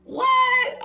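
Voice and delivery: offended yelp; shocked shout. Retold or imitated. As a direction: yelp it like he insulted you. Offended by friend.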